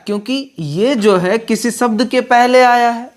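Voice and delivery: ordinary delivery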